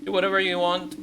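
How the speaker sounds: loud, high dismissive retort; acted exasperation. Officer warning a guest off trouble, acted exasperation. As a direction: raise the voice and brush them off.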